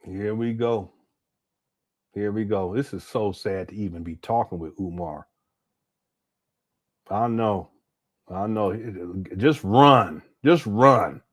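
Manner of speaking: usual speaking voice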